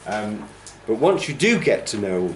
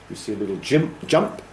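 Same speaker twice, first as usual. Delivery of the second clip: usual speaking voice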